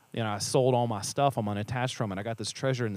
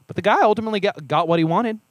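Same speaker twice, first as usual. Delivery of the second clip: higher and louder, pressing the point; emphatic; 8 semitones higher, 7 dB louder, much faster than usual. Real, not acted. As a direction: push it up with conviction. Emphatic point about rich ruler.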